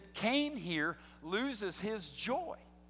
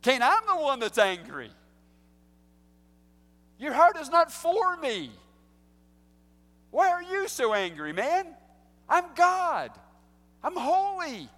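much higher, loud, indignant rebuke; God rebuking angry Cain, high and loud; 8 semitones higher, 8 dB louder than usual. Pretend. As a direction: thunder as an offended holy God. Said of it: "What could God have said?".

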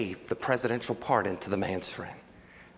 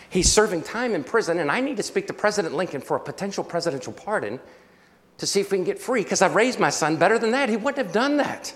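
agitated retelling; 6 semitones higher, 4 dB louder than usual. Retold or imitated. Father defending his jailed son, agitated and building. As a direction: pitch and volume climbing in retold protest. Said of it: "the guy, agitated".

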